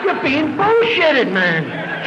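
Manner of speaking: high, slow, loud scornful jeer; high stretched-out scoff; mock scolding yell. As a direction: sneer it out like a know-it-all old-timer. A man scolding Brian at a meeting.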